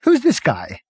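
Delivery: high, eager and giddy; can't wait to go; excited Prack. A narrator's reading. Prack to the crew, high and eager.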